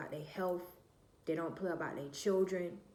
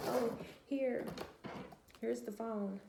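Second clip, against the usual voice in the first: lightly imitated mother, higher pitch; mother's voice; 5 semitones higher than usual. Mother offers daughter the phone, lightly imitated higher voice. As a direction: lift the pitch for mom's casual offer. Mother suddenly acting generous.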